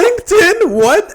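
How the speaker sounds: loud and high astonished repeat; mock outrage at the news; incredulous echo. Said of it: "echoing disbelief".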